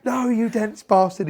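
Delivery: high, drawn-out mock yell at a boxer; imitated shouting. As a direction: imitate a coach yelling, stretching each word. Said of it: "like, shouting at him".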